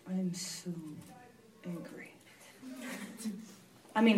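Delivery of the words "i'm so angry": soft, hushed admission of anger; barely voiced, as if confessing to God; quiet confession. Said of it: "I was like".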